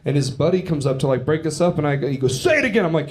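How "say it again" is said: high-pitched, aggressive imitation; mock angry demand. Comic voices the buddy insisting as he's held back, mock anger. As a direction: push the pitch up like a riled-up challenger. Offended friend demands a repeat.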